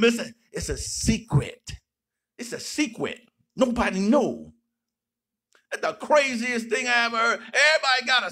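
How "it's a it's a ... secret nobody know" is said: lower, hushed-sounding mocking of secret-keepers; mock secretive voice. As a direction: tease it like a smug insider. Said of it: "tired of hearing folk talking about it's a secret".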